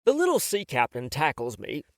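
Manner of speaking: plain reading voice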